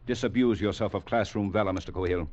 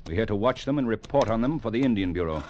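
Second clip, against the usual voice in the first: stern official rebuke. Acted. Commander correcting an eager junior officer, stern and brisk. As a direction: lay down regulations quickly and firmly.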